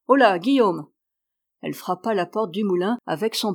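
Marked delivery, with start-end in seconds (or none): 0.1-0.9 mildly raised call, louder than the telling. A narrator's reading. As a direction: hail someone through a door. Calling at the mill door.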